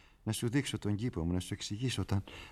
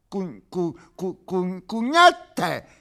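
frenzied stammer; 8 semitones higher, 8 dB louder, much slower than usual. Acted.

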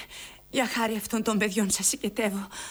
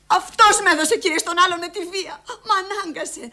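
higher and louder, pleading anger; anguished accusation; 7 semitones higher, 5 dB louder than usual. Acted. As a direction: accuse him in distress. Mother accuses the father. (απελπισμένη κατηγορία)

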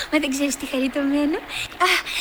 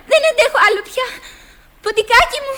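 pleading call; 6 semitones higher, 5 dB louder than usual. Acted. Pleads to mouse, exhausted swimming.

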